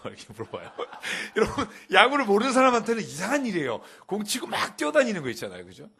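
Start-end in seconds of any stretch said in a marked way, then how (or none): 1.0-5.6 much higher and louder, laughing through the words. Real, not acted. Preacher amused by his daughter's baseball question, laughing aloud. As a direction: laugh as you speak.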